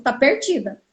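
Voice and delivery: a hint of the receptionist's pity on 'perdida'; imitating the receptionist. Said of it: "Falou desse jeito".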